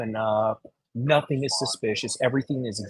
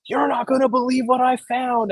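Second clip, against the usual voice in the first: high, excited disbelief; 12 semitones higher than usual. Retold or imitated.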